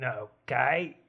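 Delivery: low, soft butler's okay; first try at a new voice. Shown as a demonstration. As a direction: test a voice quietly, pitched low. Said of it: "you'll have to change your voice".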